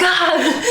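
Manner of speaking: high yelp of pain breaking into a laugh. Retold or imitated. Doctor presses again, she yelps.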